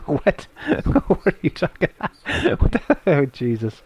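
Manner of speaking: incredulous, real laughter through the words; helpless laughing disbelief. Real, not acted. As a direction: crack up while trying to ask. Cracks up at a listener's comment.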